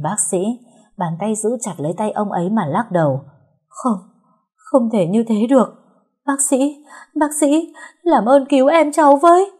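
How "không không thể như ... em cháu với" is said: high, loud begging, rising to a cry; desperate plea. Acted. Girl begging the doctor to save her sister, desperate plea. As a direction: beg with mounting desperation.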